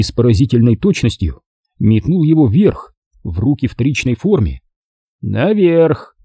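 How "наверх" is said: barked order, pitched up; sharp command. Acted. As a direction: call the order upward. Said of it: "скомандовал он".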